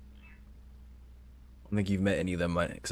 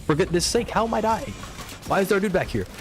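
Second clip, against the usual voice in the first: sudden shock at being killed; startled exasperation; 11 semitones higher than usual. Real, not acted. Dying in game, high exclaim.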